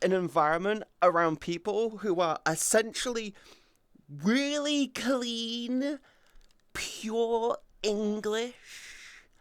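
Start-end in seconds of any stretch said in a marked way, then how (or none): 4.1-9.3 slow high prim English voice; posh English accent. Retold or imitated. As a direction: drawl it like a posh Brit.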